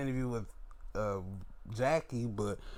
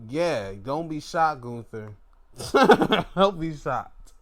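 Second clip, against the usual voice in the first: laughing tease; 7 dB louder than usual. Real, not acted. Teasing Gunther while laughing.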